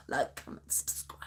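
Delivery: soft whispered sign-off; whispered jingle. Shown as a demonstration. Final sign-off jingle to viewers, whispered. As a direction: breathe the jingle out. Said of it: "Okay, I'm gonna stop".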